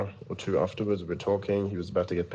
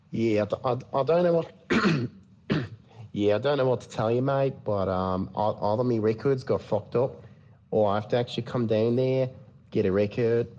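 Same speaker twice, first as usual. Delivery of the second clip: put-on higher-pitched Australian cop accent; Australian police officer; 4 semitones higher, 3 dB louder than usual. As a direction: play an Aussie cop on a prank call. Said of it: "I did an Australian police officer".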